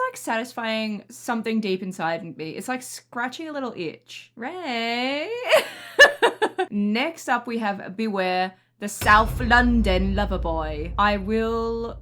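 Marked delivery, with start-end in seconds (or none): 5.4-6.7 sudden loud laugh up high; quick loud giggle. Real, not acted. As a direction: let a high laugh burst out. Reacting to the song.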